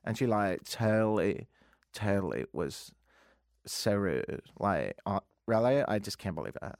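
put-on Toronto accent full of "like"; low, drawling parody of trendy Toronto talk; King West accent. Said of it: "that's all I ever hear".

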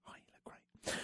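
whispered compliment; a whispered aside on air. Acted. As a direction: breathe it out in a whisper. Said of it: "whispering on the radio".

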